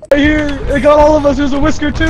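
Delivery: loud, fast panicked yelling. Real, not acted. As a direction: shout it in a panic. Monster grabs them, yelling.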